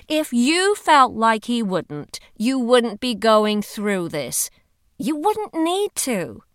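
drawn-out, pointed encouragement; insistent Heidi. A narrator's reading. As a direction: reason firmly with a doubting friend. Heidi reassures doubtful Hollis.

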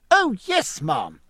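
very high-pitched, eager exclamation; boyishly keen, leaping up in pitch; eager cry. Acted. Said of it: "cried Babington".

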